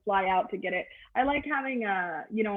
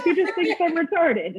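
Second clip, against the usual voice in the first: punched, raised take; louder first take; 7 semitones higher, 5 dB louder than usual. Shown as a demonstration. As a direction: say it up and loud. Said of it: "repeated softer".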